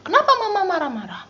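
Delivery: high, fast whiny protest; angry child talking back. Retold or imitated. Child snapping at scolding mother, high and fast. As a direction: fire it back at mom. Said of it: "kamu marah-marah juga".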